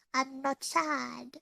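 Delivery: usual speaking voice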